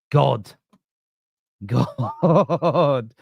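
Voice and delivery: word broken up by laughter; laughing. Real, not acted. Streamer amused by his own Santa rant, laughing. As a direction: crack up while saying it.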